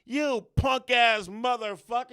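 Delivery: high, slow, loud insult; cursing yell. Retold or imitated. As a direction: spit the insult out as a shout. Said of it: "We was like".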